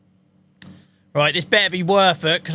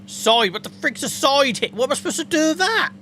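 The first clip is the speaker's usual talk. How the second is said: high, incredulous squeak of confusion; baffled; 10 semitones higher than usual. Real, not acted. Streamer baffled by a strange tractor part, high disbelief. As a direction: pitch right up in disbelief.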